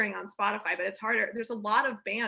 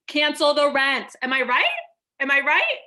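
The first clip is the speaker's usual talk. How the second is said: rallying cry; 3 semitones higher, 8 dB louder than usual. Pretend. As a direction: rouse the crowd with a slogan. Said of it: "rallying cry to crowd".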